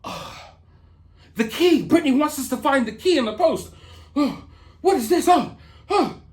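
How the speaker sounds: mock excited fan voice. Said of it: "looking at this post right now like".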